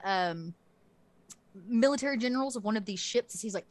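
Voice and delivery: usual speaking voice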